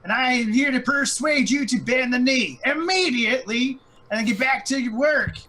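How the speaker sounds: very high, loud goblin-speech proclamation; high-pitched, full-volume harangue with drawn-out key words; York's rousing spiel. Acted. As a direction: shout it out like a herald rallying a rabble. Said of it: "jump right into his spiel".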